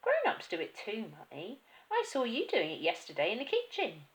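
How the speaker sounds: put-on high cheeky girl; child voice. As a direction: tattle cheekily. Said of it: "Matilda said".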